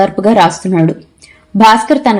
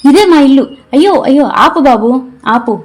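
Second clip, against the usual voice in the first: excited shout; 5 semitones higher than usual. A narrator's reading. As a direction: shout to the driver to stop. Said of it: "గట్టిగా అరిచింది".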